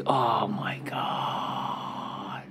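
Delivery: unvoiced whisper to himself; whispered aside. Real, not acted. Host muttering to himself on mic, whispered. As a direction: breathe it out under your breath. Annoyed aside under breath.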